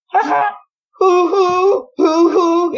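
very high owl hoots opening with a cackle; high "ha-ha" into long hollow hoots; laughing owl call. Retold or imitated. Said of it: "And then it kinda went".

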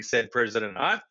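plain reading voice

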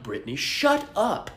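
ordinary delivery

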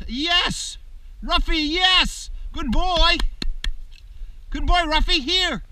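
high, loud, joyful praise for the dog; thrilled trainer praising a pup; excited praise. Real, not acted. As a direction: cheer the puppy on, way up high. Praising puppy after retrieve.

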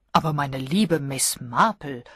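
usual speaking voice

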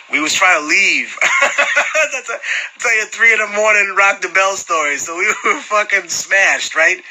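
words broken by laughter, high; laughing while telling. Real, not acted. Telling the drunk Rock the Bells story, laughing. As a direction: laugh through the memory.

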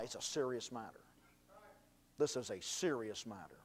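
usual speaking voice

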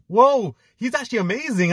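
high exaggerated amazed whoa; mocking a pundit's sudden praise; mock surprise. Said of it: "now today he's like".

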